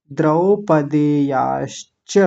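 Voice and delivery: द्रौपदेयाश्च slowly with open द्रौ; teacher demo; slow syllables. Shown as a demonstration. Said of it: "तीन जगह तोड़ लिया".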